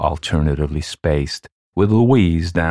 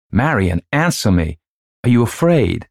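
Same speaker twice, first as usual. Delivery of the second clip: higher-pitched, urgent demand; insistent calling; 7 semitones higher, 4 dB louder than usual. A narrator's reading. Louise gets no answer from daughter, insistent. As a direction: press the child for an answer.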